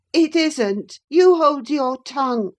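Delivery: high, loud peevish retort; high boy's angry retort; sickly boy snapping. A narrator's reading. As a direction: shut her up sharply. Linton snapping.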